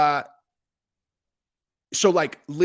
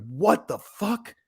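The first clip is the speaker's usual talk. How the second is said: drawn-out, higher-pitched astonishment; retold disbelief; 5 semitones higher, much slower than usual. Telling viewers about a surprise view count, stunned disbelief. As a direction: stretch it out, stunned.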